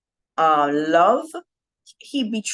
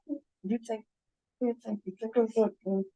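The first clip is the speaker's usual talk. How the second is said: hushed, breathy gossip between friends; whispering; 3 semitones higher, 9 dB quieter, faster than usual. Retold or imitated. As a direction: whisper it like secret table gossip. Disciples whispering among themselves.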